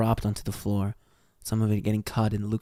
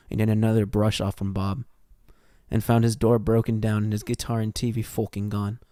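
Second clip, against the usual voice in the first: usual speaking voice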